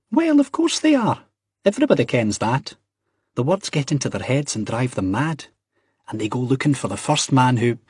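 put-on high-pitched, emphatic young Scot; Ian's know-it-all voice. Acts Ian in story.

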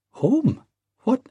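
high-pitched incredulous question; astonished, pitch leaping up; startled. Acted. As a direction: yelp it, taken aback. Ian startled at being sent home.

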